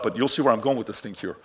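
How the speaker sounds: normal talking voice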